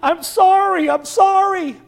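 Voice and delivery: high, loud, desperate repeated apology; a distraught outcry, pitched high and loud; anguished shouting. A narrator's reading. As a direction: cry it out over a dying friend. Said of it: "and shouted".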